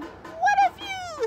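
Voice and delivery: very high, loud, stretched exclamation; thrilled young frog. A narrator's reading. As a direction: exclaim high and slow as a delighted little frog. Young frog enjoys flying.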